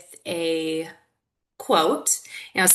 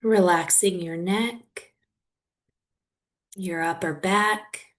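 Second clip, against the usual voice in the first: guided meditation. Real, not acted. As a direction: guide them gently through it, leaving long silences.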